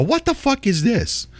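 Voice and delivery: high disbelieving outburst; incredulous mock outrage. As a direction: play baffled outrage at the internet. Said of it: "people like me, go".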